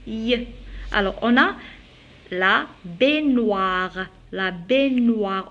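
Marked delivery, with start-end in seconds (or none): 2.3-5.5 very slow model of "baignoire", gn as ny; slow, careful "baignoire" for learners; correct gn, drawn out. Shown as a demonstration. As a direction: stretch "baignoire" with no hard g. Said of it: "On dit pas la baignoire".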